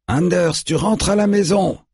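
high, sustained call across a distance; calling from afar. A narrator's reading. Old man calling Anders home from afar, high-pitched call. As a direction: call him home from far away. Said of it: "lance l'homme âgé qui se trouve encore loin".

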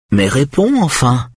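high-voiced, controlled insistence. A narrator's reading. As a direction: press for an answer, pitched high but held in check. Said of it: "dit-elle d'une voix maîtrisée".

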